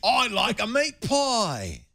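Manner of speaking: exaggerated Cockney accent, slow and high. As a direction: play a cartoon Londoner asking for a meat pie. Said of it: "give different accents".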